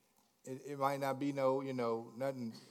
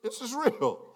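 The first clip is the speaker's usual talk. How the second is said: laughing; 7 semitones higher, 5 dB louder than usual. Real, not acted. Laughing after mock song.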